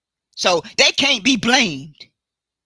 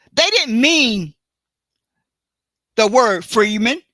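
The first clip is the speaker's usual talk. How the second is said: usual speaking voice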